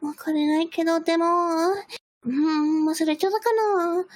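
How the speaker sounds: put-on high, sweet anime girl voice; VTuber voice. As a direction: coo like a cute streamer. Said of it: "that's how you really get the money".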